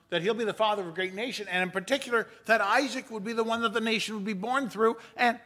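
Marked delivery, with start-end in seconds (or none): none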